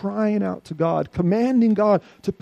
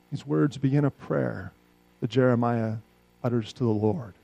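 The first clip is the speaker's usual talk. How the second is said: normal talking voice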